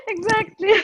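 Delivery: high, loud laugh round the word; real high laughter. Real, not acted. Laughing goodbye.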